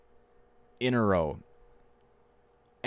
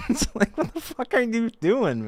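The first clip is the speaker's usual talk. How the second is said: high squealing laugh through the words; real laughter taking over the line. Real, not acted. Streamer laughing at a friend's bad French, high and squealing.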